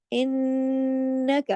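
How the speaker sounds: इन्नका with the nun hummed long through the nose, very slow; long ghunna. Shown as a demonstration. Teacher stretches the ghunna for students, very slow. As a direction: hold the nasal nun in इन्नका. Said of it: "अब देखिए ज्यादा time हुआ ना".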